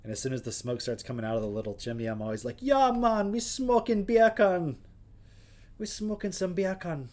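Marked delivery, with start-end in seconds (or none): 2.6-7.1 put-on higher, louder, slow Jamaican accent. Cheering on the smoking bacon in a Jamaican accent. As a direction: lean into a hammed-up Jamaican accent, drawn out. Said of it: "all I know how to say in Jamaican".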